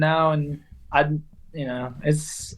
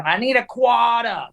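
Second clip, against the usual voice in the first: higher Boston call, "quarter" losing its r's; Boston street accent; 6 semitones higher than usual. Retold or imitated. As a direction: call it out like a Boston bucket drummer. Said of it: "like this".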